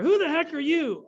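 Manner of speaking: loud, high-pitched mock outburst; imitated irate demand. Ed Asner challenges an unknown caller, imitated irate outburst. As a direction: act out an indignant phone challenge.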